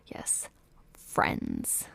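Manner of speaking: half-whispered, teasing aside; knowing aside. Real, not acted. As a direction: murmur it with sly emphasis on friends. Sardonic aside about the friendship.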